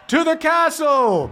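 high slow rallying yell. Acted. Mama yells to the crowd to march on the castle. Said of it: "Mama yells out".

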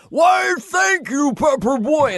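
slow, high, very loud shout of the line; hammed-up yelled thank-you; Farley's big shout. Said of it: "Chris went".

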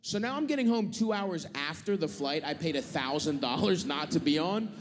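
ordinary talk

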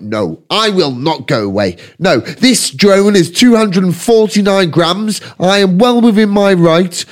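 lightly mocking, pompous auditor, slow and emphatic; stubborn auditor. Drone pilot refusing a man who asks him to leave, mock-officious. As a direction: imitate an officious, unbudging know-it-all.

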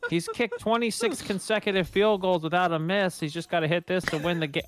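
play-by-play announcer. Retold or imitated. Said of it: "the play-by-play guy".

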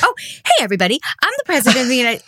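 high opening, then a rush; Tommy Metz. Retold or imitated. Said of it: "picks up another one and goes".

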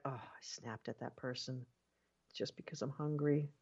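very soft, deflated self-reproach; quiet regret. Acted. Speaker voices someone regretting snapping at a person, hushed and rueful. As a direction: sink into a hushed, sheepish admission.

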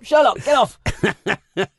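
high, laughing shout at cat; laughing scold. Real, not acted. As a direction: shoo the cat while laughing.

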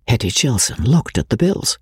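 plain reading voice

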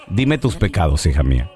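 low priest voice; confessor priest. Acted. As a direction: drop deep to hear a confession. Priest hearing a woman's confession.

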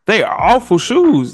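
ordinary talk

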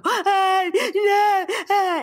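high, loud mock toddler howl; toddler tantrum wail. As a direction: wail like a toddler resisting comfort. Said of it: "And they would go".

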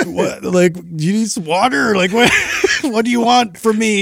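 high-pitched mock helplessness breaking into laughs; flustered, laughing. Flustered trainer asks crying client what she needs, laughing. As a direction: plead in mock bewilderment, laughing as you go.

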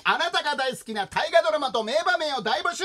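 high, loud announcer's shout; rousing show-opening announcement; radio title call. Pretend. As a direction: belt out the programme intro.

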